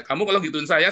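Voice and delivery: ordinary talk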